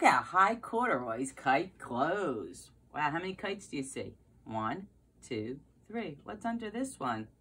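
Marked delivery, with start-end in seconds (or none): none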